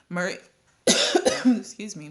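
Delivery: usual speaking voice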